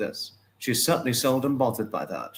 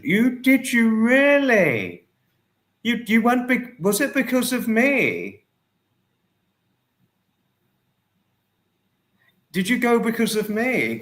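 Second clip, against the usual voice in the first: can't believe they went because of you; amazed disbelief; 10 semitones higher, 3 dB louder than usual. Real, not acted. Asking viewer if the trip was because of him, amazed and high.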